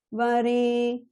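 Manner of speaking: varenyam chanted with the re split into two notes, re then a; split syllable. Shown as a demonstration. Teacher showing the split syllable, chanted. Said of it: "The sound re is split into re and a".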